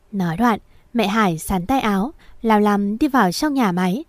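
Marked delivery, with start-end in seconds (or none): none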